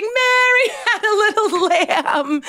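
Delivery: very high, laughing recital; hammed-up giggly high voice. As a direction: laugh your way through the line. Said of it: "Try it like you're laughing".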